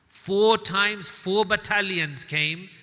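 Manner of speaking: loud, slow emphatic count; preacher hammering the count of four, loud and slow. Acted. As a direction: punch the number home.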